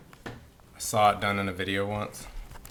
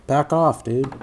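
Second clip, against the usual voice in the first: loud; 3 semitones higher, 8 dB louder, slower than usual. Real, not acted. Shooing someone from the camera.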